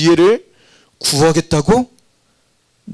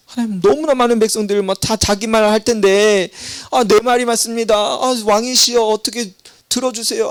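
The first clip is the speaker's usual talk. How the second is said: higher, eager voice of a subject begging the king; pleading petitioner; 7 semitones higher than usual. Acted. Subject begging King Solomon, higher pleading voice. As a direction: plead with the king to hear you first.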